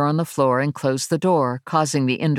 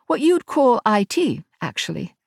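higher-pitched Englishwoman's voice; English accent; 8 semitones higher than usual. A narrator's reading. Ash introduces herself to Verity, English accent. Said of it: "a woman with an English accent".

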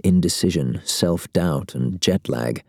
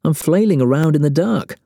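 complaining; 10 semitones higher, 3 dB louder than usual. A narrator's reading. Bob complaining on.

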